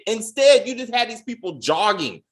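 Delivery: normal talking voice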